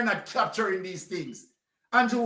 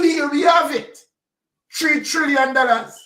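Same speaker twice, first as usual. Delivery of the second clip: emphatic outrage; 5 semitones higher, 5 dB louder than usual. Real, not acted. Ranting about missing billions.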